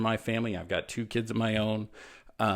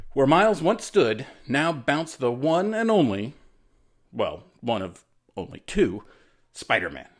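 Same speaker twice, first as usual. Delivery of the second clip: louder, slowed announcer flourish; dramatic announcer reveal; 6 dB louder than usual. A narrator's reading. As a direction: build it up like a comic-book reveal.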